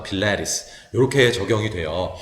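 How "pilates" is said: "필라테스" said in English, pi-LAE-rees; stressed "ae" vowel. Shown as a demonstration. Teacher showing the accented vowel, English-style "필라테스". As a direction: say "필라테스" as English Pilates with a strong middle "ae". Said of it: "에로 발음하겠죠".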